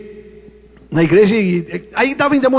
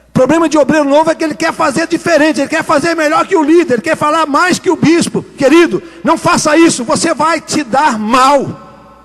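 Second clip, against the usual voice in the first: preaching at full force; 5 semitones higher than usual. Acted. Preacher warns new church workers, preaching hard. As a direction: drive the warning home.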